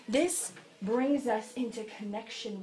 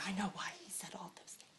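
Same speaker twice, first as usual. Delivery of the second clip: near-whisper, much softer; hushed mock claim; 5 semitones lower, 15 dB quieter, faster than usual. Pastor voices a claim he won't make, hushed aside.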